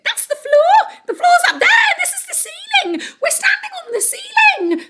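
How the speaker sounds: high panicked shrieks; panicked shrieking. Acted. Missus Twit panicking.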